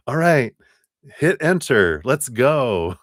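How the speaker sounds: high, loud, eager kickoff; high excited announcement. Real, not acted. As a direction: launch it with a bright cheer. Excited PowerPoint start.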